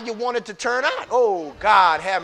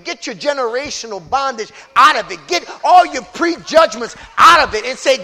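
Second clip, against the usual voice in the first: usual speaking voice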